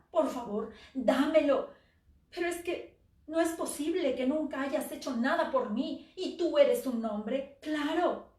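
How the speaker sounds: loud, high pleading that turns to scorn; crying out loudly at her husband; desperate begging. Acted. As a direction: beg for it, then lash out at him.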